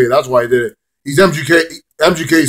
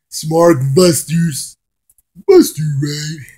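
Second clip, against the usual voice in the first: drawn-out, put-on silly voice; silly name riff; 3 semitones higher, much slower than usual. Riffing on the reviewers' name, playful drawl. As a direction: stretch each word slowly, playing with it.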